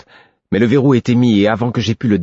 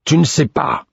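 higher, angry growl, stress on "ne"; 9 semitones higher, 4 dB louder than usual. A narrator's reading. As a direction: throw the words back at him in anger. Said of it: "gronda-t-il". (grondé avec colère)

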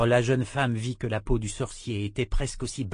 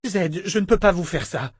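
high, loud, pleading protest; distressed refusal; 8 semitones higher, 5 dB louder than usual. A narrator's reading. Woman refusing the trapped wizard again, distressed. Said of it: "répéta Callan".

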